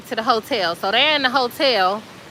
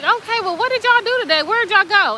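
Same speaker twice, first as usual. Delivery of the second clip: high, put-on questioning owner; mock dog owner; 8 semitones higher than usual. The dog's owner quizzing the pets, high mock voice. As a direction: ask the pets where they went, high.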